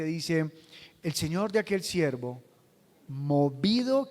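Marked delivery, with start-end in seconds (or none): 3.1-4.1 drawn out. Shown as a demonstration. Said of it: "¿qué?".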